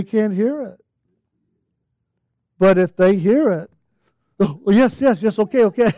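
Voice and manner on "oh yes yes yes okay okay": hurried, flustered agreement; startled compliance. Acted. Soul who finally hears the yell, hastily agreeing. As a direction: answer as if just jolted awake.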